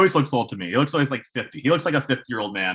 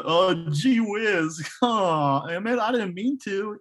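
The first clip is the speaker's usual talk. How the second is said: high, slow, bashful gee-whiz voice; aw-shucks young cowboy; 8 semitones higher, slower than usual. Retold or imitated. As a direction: play the sheepish farm boy. Imitating Costner's aw-shucks character.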